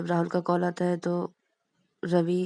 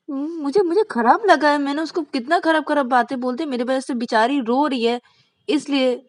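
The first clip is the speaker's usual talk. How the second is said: much higher and louder, rushed and stammering; flustered protest; 8 semitones higher, 8 dB louder than usual. Acted. Teen boy to his teasing friends, panicked denial. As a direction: deny it in a panicked rush.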